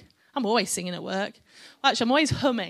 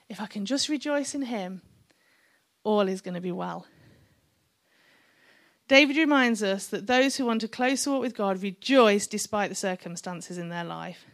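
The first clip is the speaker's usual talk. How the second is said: ordinary talk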